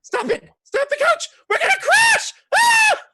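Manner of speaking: very high, loud shrieking that builds to a wail; panicked scream. Acted. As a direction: scream for the coach to stop before it crashes. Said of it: "and screams".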